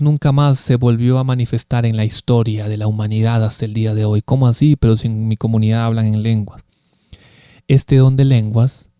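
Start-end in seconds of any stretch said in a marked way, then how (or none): none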